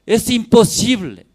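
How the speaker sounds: loud, high insistence; emphatic. Acted. Preacher insisting to the congregation, loud. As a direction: hammer the point home.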